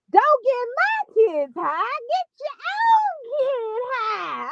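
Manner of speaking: high, slow mock wail; imitating how she said it. Host quoting a woman's live to viewers, exaggerated high wail. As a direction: drag it out in a high mocking whine. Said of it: "I cannot believe she said it like that".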